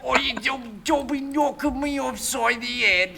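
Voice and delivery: high, loud Cockney mugger voice; aggressive Cockney accent. Acted. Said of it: "my aggressive Cockney accent".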